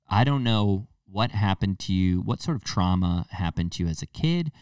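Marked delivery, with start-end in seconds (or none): none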